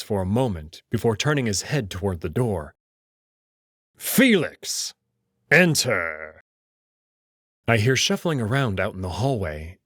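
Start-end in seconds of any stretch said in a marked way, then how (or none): 4.0-6.4 commanding call. Acted. Kato calling the doctor into the room. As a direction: summon a servant with authority.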